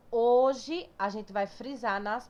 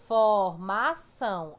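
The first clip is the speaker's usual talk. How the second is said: "Formação" slow and loud, syllable by syllable; split into syllables; 5 dB louder, much slower than usual. Shown as a demonstration. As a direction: break "Formação" into for-ma-ção, last syllable strong. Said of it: "como é mais forte".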